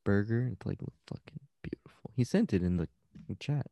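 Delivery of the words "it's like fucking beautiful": breathless whisper of awe. Real, not acted. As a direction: whisper it like it's sacred. Awe at the burger.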